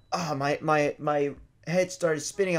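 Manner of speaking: ordinary delivery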